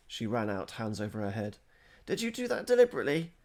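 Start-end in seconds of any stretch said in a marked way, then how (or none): 2.1-3.3 high, loud and fast accusation; challenging. A narrator's reading. Girl confronting the boys who showered her, high, fast and loud. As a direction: demand an answer, quick and indignant. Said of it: "Ingeborg challenged them".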